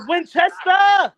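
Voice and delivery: shouted guess. Real, not acted. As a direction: belt out the team name, slow and proud. Guessing the English team name.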